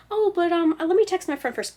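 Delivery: acted procrastinator protest; stalling inner voice. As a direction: plead for a little delay in a mock-whiny lift. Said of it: "the thoughts would get more, like, closer together".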